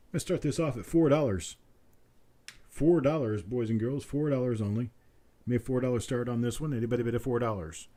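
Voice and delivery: rapid auctioneer patter, looping the price; auctioneer bid calling. Acted. Auctioneer calling for bids from stream viewers, quick rolling patter. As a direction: rattle off the bid call like a sale barn auctioneer.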